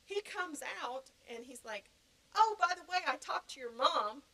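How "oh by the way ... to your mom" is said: high, loud, pleased announcement; imitated triumphant boy. Boy telling her he talked to her mom, triumphant. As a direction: announce it like you have won.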